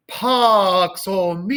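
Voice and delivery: "Pax hominibus" drawn out, long vowels before X and S; slow sustained choral delivery of Pax hominibus; late final consonants. Shown as a demonstration. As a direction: hold "bus" long, S only at the end. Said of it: "same with the boost at the end".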